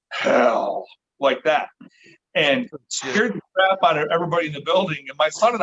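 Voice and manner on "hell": lower, mock-gruff one-word growl of a spirit; deep gruff ghost voice. Spirit box answers where to find Bigfoot: low mock-gruff voice. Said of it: "big, deep, gruff voice comes through".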